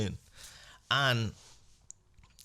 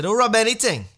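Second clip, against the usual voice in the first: high, loud alarmed yell; loud frantic shriek telling the physio to stop; panicked shout; 12 semitones higher, 11 dB louder than usual. Retold or imitated. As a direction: yelp it before she touches you.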